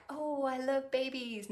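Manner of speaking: put-on soft, slow coo of a baby-loving mother; mock doting mom. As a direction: imitate a gushing mom, hushed and drawn out. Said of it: "that mom that's like".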